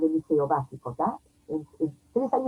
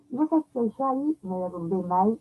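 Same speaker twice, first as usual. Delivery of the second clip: ordinary delivery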